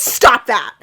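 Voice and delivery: loud, sudden order to stop; acted shouted order; sharp command. A narrator's reading. Link barges in, acted command.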